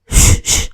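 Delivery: urgent whispered shush-shush. Acted. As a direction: hiss a quick double shush. Driver shushing the boy. (గుసగుసగా ష్ ష్)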